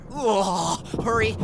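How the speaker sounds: ordinary talk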